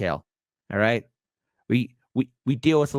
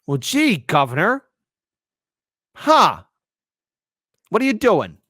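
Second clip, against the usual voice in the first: higher and louder sarcastic jab; mock exasperation; 5 semitones higher, 6 dB louder than usual. Real, not acted. Host jabbing at the governor, sarcastic exasperation. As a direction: needle him sarcastically.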